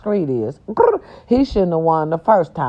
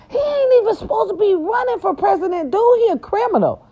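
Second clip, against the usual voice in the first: high mock outrage. Real, not acted. Mocking outrage at criminal candidate.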